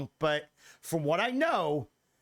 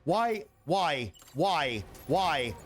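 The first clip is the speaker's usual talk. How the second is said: normal talking voice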